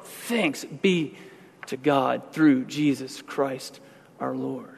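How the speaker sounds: slow, soft thanksgiving; quiet grateful prayer; grateful relief. Acted. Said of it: "you come out of there and you say".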